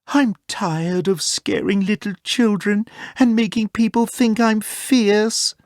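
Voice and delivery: gentle bear sighing to himself, lightly sad. A narrator's reading. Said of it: "thought Ted sadly".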